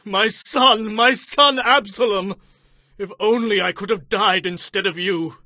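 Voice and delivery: high, anguished wail; full-voiced lament; crying out in grief. A narrator's reading. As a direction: mourn aloud as a bereaved father. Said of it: "he cried".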